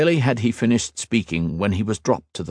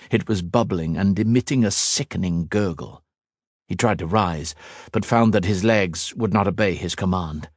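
usual speaking voice

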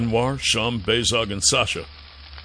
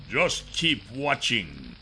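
slow, softer sneering villain; quiet menacing villain; 7 dB quieter, slower than usual. Acted. Kryzak quiet.

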